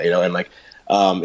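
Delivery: normal talking voice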